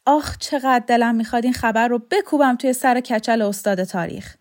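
put-on a touch of triumphant old man; gleeful old man. A narrator's reading. Old man longs to tell the history teacher, gleeful outburst.

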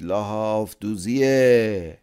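drawn-out peddler shout carrying down the street; street vendor's loud call. A narrator's reading. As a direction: call out the trade down the alley. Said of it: "با صدای بلند گفت".

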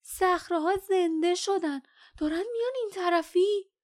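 high, loud cry of urgent news; breathless alarm. A narrator's reading. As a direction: call out in alarm, pitched well up. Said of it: "دوان دوان آمد و نفس زنان گفت".

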